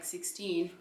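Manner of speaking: ordinary talk, nothing marked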